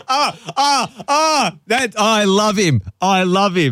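high-pitched, recovering from a big laugh; real helpless laughter. Real, not acted. Host cracking up at the guest's slang, helpless laughter. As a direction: gasp it out on the tail of laughing.

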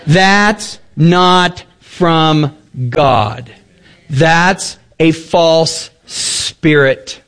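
very slow, weighty pronouncement; solemn verdict. Real, not acted. Preacher condemning the revival to his flock, slow and grave. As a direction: lay it down slowly, word by word.

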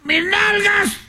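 high shouted frog panic; frog's cry. Acted. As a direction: shriek it high like a frog missing its butt.

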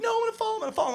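put-on high whiny scared kid voice; nervous camper refusing to skate, high and whiny. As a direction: whimper it like a frightened child.

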